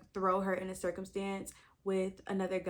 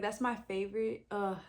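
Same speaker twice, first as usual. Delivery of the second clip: ordinary talk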